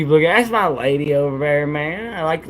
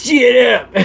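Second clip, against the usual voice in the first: laughing; 7 semitones higher, 6 dB louder than usual. Real, not acted. Laughing while saying motto.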